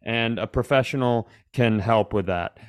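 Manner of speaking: ordinary talk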